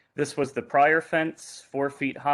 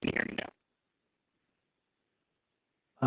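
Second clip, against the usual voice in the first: choppy, distorted connection; garbled audio; 4 dB quieter, much faster than usual. Real, not acted. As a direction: voice breaking up over a bad mic. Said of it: "your audio is, um, garbled".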